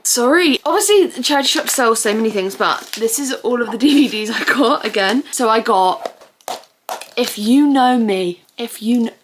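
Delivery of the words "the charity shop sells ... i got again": ordinary delivery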